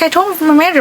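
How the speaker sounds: ordinary talk, nothing marked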